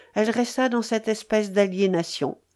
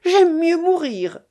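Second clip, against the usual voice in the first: high, loud desperate outburst; dramatic, frightening exclamation; violent cry; 9 semitones higher, 6 dB louder than usual. A narrator's reading. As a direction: burst out violently, pitch leaping up. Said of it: "elle s'écria violemment".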